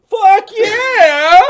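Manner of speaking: high, loud whoop; excited shout. Pretend. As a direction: yell it in total delight. Excited yes to partying.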